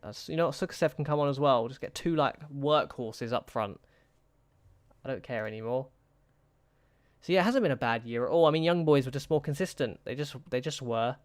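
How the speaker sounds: normal talking voice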